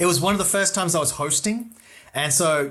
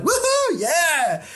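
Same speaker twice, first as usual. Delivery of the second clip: high-pitched imitation of a cheering crowd; long, soaring mock cheer; crowd whooping; 13 semitones higher, 3 dB louder, much slower than usual. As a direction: whoop like a thrilled audience member. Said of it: "like, whooping".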